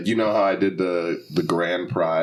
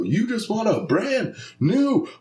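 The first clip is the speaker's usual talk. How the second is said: very high, slow prize reveal; game show announcer; slower than usual. Pretend. Host revealing the surprise raffle prize, high hammed-up announcer. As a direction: draw out every word like a big winner announcement.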